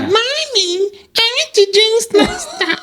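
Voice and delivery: put-on very high, slow childlike voice; like a toddler asking mommy; baby voice. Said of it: "and I hear".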